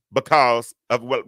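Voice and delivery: ordinary delivery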